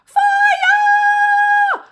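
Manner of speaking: very high, very loud panicked shriek; grandma shrieking fire; yell. A narrator's reading. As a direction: scream the alarm. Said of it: "the old woman yelled".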